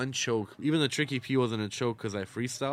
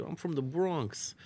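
imitated Bronx girl, higher and slow; retold Bronx accent, quiet and raised in pitch; 5 semitones higher, 7 dB quieter than usual. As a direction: softly drawl it in a Bronx accent. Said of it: "She had a hot ass accent".

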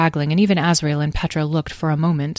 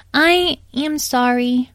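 high, slow little-pony voice; sheepish tiny pony; 8 semitones higher, 3 dB louder, slower than usual. Acted. Tiny pony owns up to the herd, sheepish. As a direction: apologise slowly in a small, high voice.

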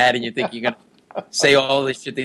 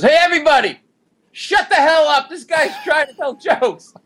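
retold screaming; 13 semitones higher than usual. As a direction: yell to silence the whole room. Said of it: "Every time he screamed".

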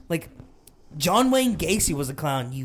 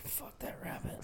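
hushed whisper, breath only; a whispered aside of disgust; whispered curse; 20 dB quieter than usual. Real, not acted. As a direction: spit it out under your breath. Muttered disgust at rabbit.

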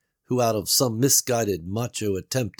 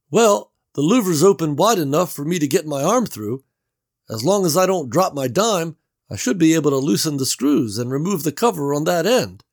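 raised, louder character voice for Gary; Gary calling through the wall; Gary's voice; 8 semitones higher, 7 dB louder than usual. A narrator's reading. Gary calls through the wall to Bev, raised voice.

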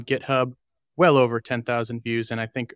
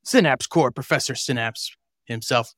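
loud showman announcer; ringmaster; 5 dB louder than usual. Acted. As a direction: roll up the crowd like a circus ringmaster. Podcast show opening announcement.

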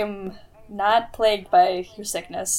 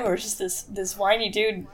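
ordinary talk

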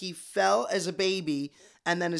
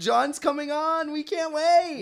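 excited; 10 semitones higher, 4 dB louder than usual. Real, not acted. Hyping the guest's upcoming visit.

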